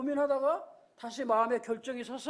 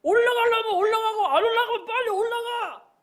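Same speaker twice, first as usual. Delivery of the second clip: loud, high yell from behind; impatient shouting; 7 semitones higher, 7 dB louder, slower than usual. Retold or imitated. Kids behind yell at the stalled boy, impatient shouting. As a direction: shout at the kid blocking the slide. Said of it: "뒤에서는 막 소리칩니다".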